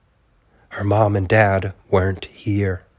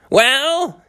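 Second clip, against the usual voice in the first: high tiny raging voice. Acted. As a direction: rage like a hopping mad turtle. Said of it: "he raged".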